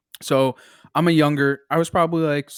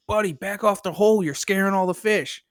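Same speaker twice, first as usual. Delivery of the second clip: higher-pitched mock scolding; retold annoyance; 5 semitones higher than usual. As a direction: imitate an annoyed angler telling someone off. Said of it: "he's, like, all mad at me".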